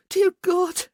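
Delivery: high and loud cry; ecstatic cry. A narrator's reading. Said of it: "she chanted".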